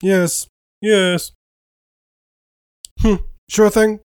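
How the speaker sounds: squeaky frog puppet impression; Kermit voice. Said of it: "Yeah, it's Kermit".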